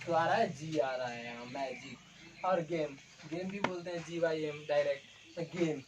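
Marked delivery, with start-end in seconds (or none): 1.4-2.4 magic stretched out slowly and softly; slow drawn-out demo. Shown as a demonstration. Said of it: "बोल के देखिए". (Magic को धीरे-धीरे और धीमे खींचकर)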